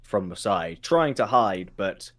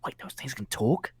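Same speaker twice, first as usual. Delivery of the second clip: hushed, barely voiced aside; very quiet mouthing; 7 dB quieter than usual. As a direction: mouth it under your breath, scared. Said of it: "mouthing very quietly like".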